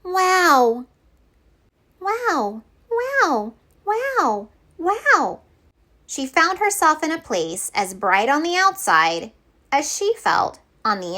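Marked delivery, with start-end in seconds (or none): 0.0-5.4 high squeaky little creatures; tiny puff voices. Acted. Puffs greeting Betty with high squeaky wows. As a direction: chirp wow like tiny fluffy puffs.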